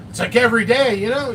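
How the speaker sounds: ordinary talk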